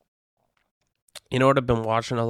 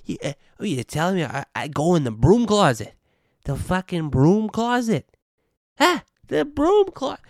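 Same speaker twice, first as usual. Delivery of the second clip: indignant Italian-American comic voice, rising higher; Sebastian Maniscalco impression; 7 semitones higher, 5 dB louder than usual. Retelling the Trump interview as Maniscalco, exasperated. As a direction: build from a breathy start to a high outraged squeal. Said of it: "it's giving me, like, Sebastian Maniscalco".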